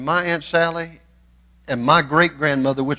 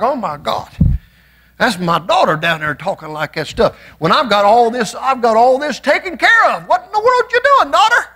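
God as an exasperated father, rising high and fast at the end; 9 semitones higher, 4 dB louder than usual. Retold or imitated. Preacher voicing God scolding a doubting daughter, high and exasperated. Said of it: "but it's like".

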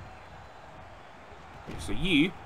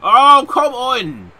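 long, high, loud exasperated yell; worked-up yell; 12 semitones higher, 13 dB louder, much slower than usual. Real, not acted. Gamer exasperated at the match, worked-up yell. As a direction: wail it slowly at full volume. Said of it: "very worked up now".

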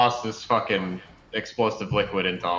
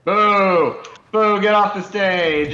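loud booing heckler; loud drunk heckling; 10 semitones higher, 9 dB louder, much slower than usual. Pretend. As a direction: jeer him off the stage. Heckling a stage performer.

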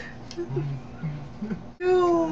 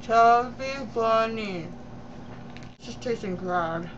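mouth full; 2 semitones lower than usual. Real, not acted. Chubby Bunny challenge, marshmallows in mouth.

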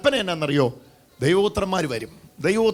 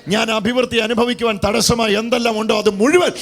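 driving revival declaration; forceful preaching; 4 semitones higher, 5 dB louder than usual. Acted. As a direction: declare it boldly to the congregation.

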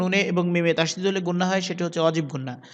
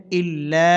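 "ইল্লা" with the last a drawn out; lengthened vowel; 4 dB louder, much slower than usual. Shown as a demonstration. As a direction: hold the final vowel of ইল্লা for one long beat. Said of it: "এক আলিফ টানতে হবে". (ইল্লা-র শেষ স্বর টেনে)

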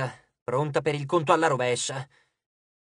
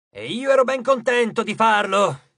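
loud, higher proud exclamation; exclaiming; 5 semitones higher, 6 dB louder than usual. A narrator's reading. Titan boasting to his brothers about a killing, exclaiming. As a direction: burst out boastfully. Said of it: "esclamò Iperione".

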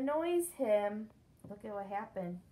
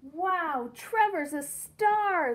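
high, loud, slow amazed wow; excited photographer; 7 semitones higher, 8 dB louder, much slower than usual. Acted. Photographer praises Trevor instead, loud and amazed. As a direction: marvel at the little dog. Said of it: "the photographer said".

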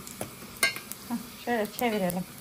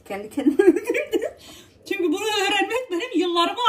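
laughing; 8 semitones higher than usual. Real, not acted. Laughing at own bragging.

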